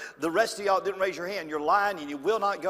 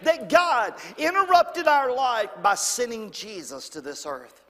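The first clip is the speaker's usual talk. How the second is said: ordinary delivery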